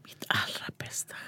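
whispered echo of the question; half-whispered musing. Real, not acted. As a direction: breathe it out to yourself. Repeating the question to himself.

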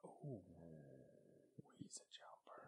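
breathy hushed whisper. Real, not acted. Stalking the spider, whispering to the host. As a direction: whisper so the spider won't hear.

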